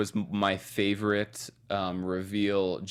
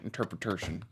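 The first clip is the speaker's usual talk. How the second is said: INTERPRETATION drawled softly and lower in mock Southern; Blanche voice; 3 semitones lower, 8 dB quieter than usual. Shown as a demonstration. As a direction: drop low on INTERPRETATION, Southern-belle style. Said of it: "That's my interpretation".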